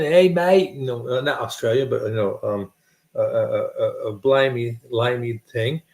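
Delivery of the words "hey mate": loud mock English greeting; English accent. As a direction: hail a mate in English accent. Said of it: "[name] got the nice English accent".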